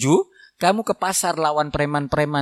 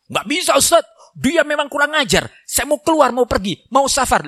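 high, agitated husband protesting to the ustaz; angry husband; 10 semitones higher than usual. Acted. Husband insisting to the preacher he will leave, angry. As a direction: play the fuming husband who wants to storm out.

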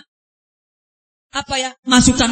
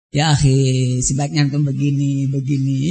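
gentle advice; 11 semitones lower than usual. Acted. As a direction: imitate someone advising very mildly, voice dropped low. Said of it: "tapi nggak seperti Ana ngomong gini".

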